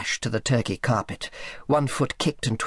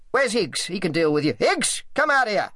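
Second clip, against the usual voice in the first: higher, irritable boss voice; blustering summons; 8 semitones higher than usual. A narrator's reading. Selby calls Higgs to deal with Sally, blustering. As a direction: call out impatiently for an employee.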